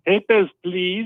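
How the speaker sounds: mock German official, slow German accent. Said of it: "and my German accent".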